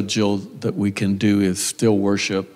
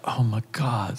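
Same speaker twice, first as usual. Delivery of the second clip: breathless whispered gasp of disbelief; shocked whisper; 7 dB quieter than usual. Retold or imitated. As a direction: whisper it, stunned by bad news. Retold shock at death news.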